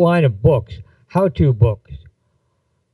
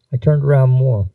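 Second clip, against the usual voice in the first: ordinary delivery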